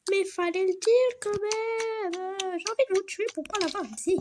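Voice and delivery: high, loud mock outrage; exasperated shopkeeper. Shopkeeper outraged at not hearing about the birthday sooner, exasperated. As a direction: scold the customer, voice jumping up high. Shopkeeper scolds hurried customer.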